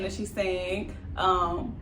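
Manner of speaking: ordinary talk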